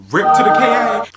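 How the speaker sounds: laughing, high loud mock lament for a ruined hairdo; loud mock mourning. Real, not acted. Friend mourning her ruined hair, high loud mock lament. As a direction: cries out in half-laughing mock grief.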